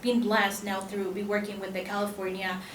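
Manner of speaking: ordinary delivery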